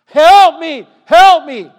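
loud, high cry for help; drowning man screaming for help from the water; desperate scream. Pretend. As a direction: scream it from the top of your lungs. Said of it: "you screamed on the top of your lungs".

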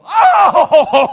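long, high, pulsing wail; hammed-up wavering "oh-ho-ho" of agony; exaggerated howl of pain. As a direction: wail loudly as if really hurt. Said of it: "When you get really hurt".

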